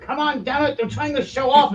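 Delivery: very high, strained gnome scolding; exasperated gnome. Acted. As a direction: plead crossly with a balky machine. Gnome scolds his failing machine.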